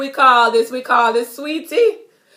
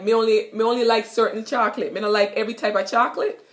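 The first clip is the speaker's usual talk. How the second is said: fast Jamaican patois phrasing; faster than usual. Shown as a demonstration. Teacher demonstrating patois for learners, quickly. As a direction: rattle it off Jamaican style. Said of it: "you could be like".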